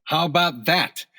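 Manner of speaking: exultant. Acted. Agent shows doctor his handgun score, exultant. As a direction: crow a little over your score.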